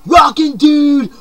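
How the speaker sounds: high, loud, slow Rockin' dude with a punch on rockin'; shouted catchphrase. Acted. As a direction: belt out Rockin' dude like a rock cry. First take, shouted.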